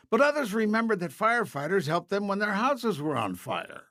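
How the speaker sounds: ordinary delivery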